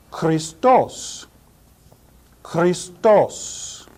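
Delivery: Christos as KHREE-stoss, hard kh; Christos, slow demo; Greek pronunciation. Shown as a demonstration. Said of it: "Greek vocabulary drill".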